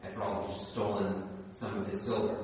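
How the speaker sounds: usual speaking voice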